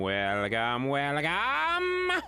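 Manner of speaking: sing-song announcer welcome; drawn-out welcome. Pretend. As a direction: stretch each welcome out.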